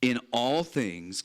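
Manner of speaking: slow, stressed "In all things, God works for the good"; slow emphatic quote. Shown as a demonstration. Preacher slowing down to re-read the verse for the congregation. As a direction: read each word slowly, weighing "for". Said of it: "He says".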